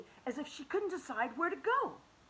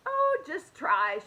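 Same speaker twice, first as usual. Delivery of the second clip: slow, louder grandmotherly coaxing; gentle encouragement; 5 semitones higher, 8 dB louder, slower than usual. Acted. Granny Irene encourages Hoppy, slow and gentle. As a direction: urge him on kindly.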